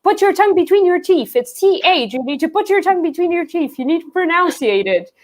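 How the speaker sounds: nagging English teacher. Retold or imitated. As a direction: mimic a teacher drilling pronunciation. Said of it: "my English teacher in Portugal, he would always tell me".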